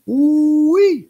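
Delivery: a high, loud whooping ooh-wee; excited ooh-wee. Real, not acted. Amazed reaction to money lesson.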